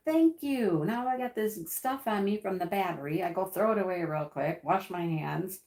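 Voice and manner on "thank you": high, loud mock-grateful thank you; sarcastic thanks. To husband after getting acid on her, sarcastic thanks. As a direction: thank him with a dry edge. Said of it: "and I'm like".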